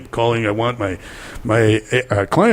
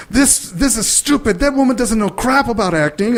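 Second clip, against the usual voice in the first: higher-pitched, half-mock indignant complaint; fuming about the casting director, lightly played; mildly angry rant; 11 semitones higher than usual. Retelling anger at the casting director, mild high rant. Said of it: "and I'm really mad".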